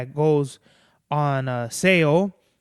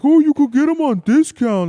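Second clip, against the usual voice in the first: high, loud mock voice of bargain hunters; 10 semitones higher, 8 dB louder than usual. Quoting people who push discount buying, in a high mocking voice. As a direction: ham up the people who tell him to buy on discount. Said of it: "people are like".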